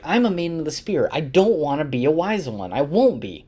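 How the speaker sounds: high, petulant mock refusal; acted protest. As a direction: imitate a stubborn young warrior objecting. Aviendha protests being made wise one.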